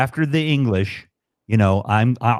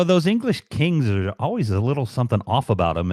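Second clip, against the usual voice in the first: ordinary talk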